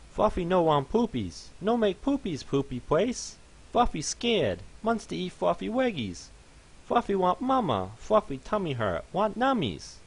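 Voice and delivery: a scared pony begging for mama; whimpering fluffy pony. Acted. Crying pony in box.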